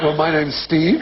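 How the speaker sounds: high, STEVE rising questioningly; upspeak. Acted. Answering his introduction in like-speak, mock upspeak. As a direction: lift "Steve" as if asking. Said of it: "I was like".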